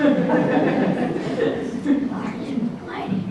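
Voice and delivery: a quiet airy chuckle; soft real laugh. Real, not acted. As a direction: breathe out a wry little laugh. Laughing about political differences.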